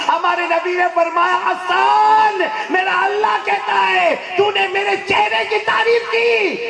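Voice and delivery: high, loud, slow fervent proclamation; preaching at full force. Acted. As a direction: thunder it out to the congregation. Fiery climax of the story. (پُرجوش بلند خطابت)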